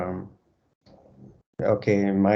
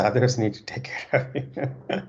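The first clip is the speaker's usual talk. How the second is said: laughing; 4 semitones higher than usual. Real, not acted. Teacher jokes, laughing as she speaks.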